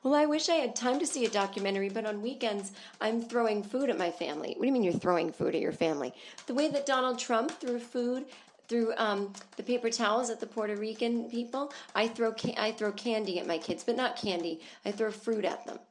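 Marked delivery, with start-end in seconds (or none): 0.0-4.5 higher, quick put-on mom voice; mocking the live laugh love lady. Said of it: "live, laugh, love, lady". 6.5-15.8 higher put-on mom voice; mocking the live laugh love lady. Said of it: "live, laugh, love, lady".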